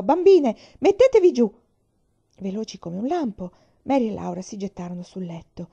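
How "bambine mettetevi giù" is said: put-on loud urgent cry to the girls; shouted warning. A narrator's reading. Mother shouting at the girls to get down. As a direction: shout it, the wagon's in danger. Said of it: "mamma gridò".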